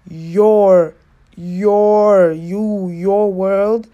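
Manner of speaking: "your" stretched out and loud; loud, drawn-out stress on "your"; emphatic YOUR. Real, not acted. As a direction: lean hard and long on each "your". Stressing whose values are imposed.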